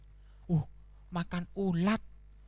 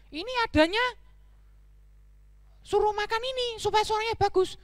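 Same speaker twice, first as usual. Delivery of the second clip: very high whiny voice; squeaky, doubtful donkey; reluctant donkey; 12 semitones higher than usual. Acted. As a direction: whine about being told to eat it. Donkey doubts the leaf diet.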